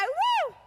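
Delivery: "woo" muy agudo de celebración; grito de júbilo. Acted. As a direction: suelta un grito agudo de alabanza. Imita brincar y alabar con júbilo. (¡woo! agudo y festivo)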